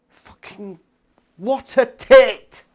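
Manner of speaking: loud, high, slow exclamation; exasperated insult. Real, not acted. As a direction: stretch the insult out in loud disgust. Insulting the hero in outrage.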